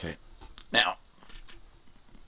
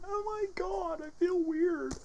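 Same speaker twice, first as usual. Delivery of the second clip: squeaky falsetto, much higher; slower than usual. Pretend. Very high put-on voice.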